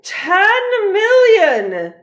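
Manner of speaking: slow, very loud, high bellow; furious shout. Acted. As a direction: roar the number at her. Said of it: "shouted the archduke".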